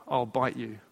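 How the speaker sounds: low, quiet deadpan threat. Retold or imitated. Lady warns dad off the train window, deadpan threat. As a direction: issue the threat softly and low, in all seriousness. Quoting the lady's threat, then repeating it.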